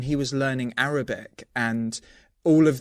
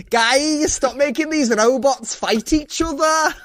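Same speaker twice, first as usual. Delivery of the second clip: high, loud mock Scouse yell; badly done Liverpudlian host shouting at the robots; hammed-up Scouse telling robots off; bad Liverpool accent; 10 semitones higher, 4 dB louder, slower than usual. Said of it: "I'm so bad at this accent".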